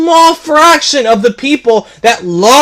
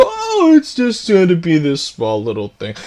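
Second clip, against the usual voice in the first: mock dismissive quote; 6 semitones lower, 6 dB quieter than usual. Gamer mocking the studio shrinking its hyped news, scornful. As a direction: belittle it like a bored spokesman. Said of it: "and then they just go".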